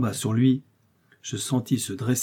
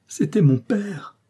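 cried out; 9 semitones higher, slower than usual. A narrator's reading. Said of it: "cria-t-il en revenant à lui".

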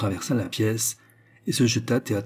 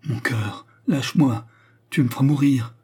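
gasping; 5 semitones higher, 4 dB louder than usual. Acted. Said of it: "dit-il, haletant".

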